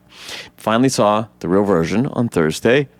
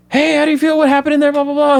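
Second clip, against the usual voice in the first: high, mock reporter barrage; reporters shouting; faster than usual. Mimicking the press mobbing Barry outside court, high hammed-up clamor. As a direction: rattle off questions like a crowd of reporters, much higher. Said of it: "the press swarms around him".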